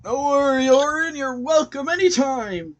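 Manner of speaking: loud, high, jolly merchant; hearty shopkeeper. Acted. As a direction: boom a cheerful welcome. Shopkeeper bids warm farewell.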